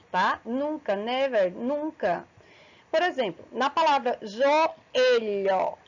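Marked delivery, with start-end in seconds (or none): 4.2-5.8 "joelho" slowly split as jo-e-lho; separated vowels. Shown as a demonstration. Teacher giving an example of hiatus, vowels slowly separated. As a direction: pull the O and E of "joelho" apart. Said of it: "elas estão separadas".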